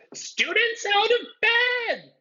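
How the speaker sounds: very high, loud slow poltergeist bellow; drawn-out bellowing ghost alarm. A narrator's reading. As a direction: raise the alarm like a shrieking ghost. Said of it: "Peeves bellowed".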